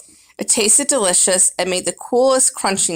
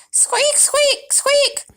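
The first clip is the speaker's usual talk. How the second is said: high squeaks; much slower than usual. Acted. Hamster tries to answer.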